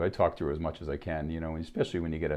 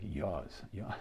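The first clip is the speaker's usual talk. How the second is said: soft, R-less Boston "yours", like "yahz"; Boston accent; 9 dB quieter than usual. Shown as a demonstration. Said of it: "I still get that accent".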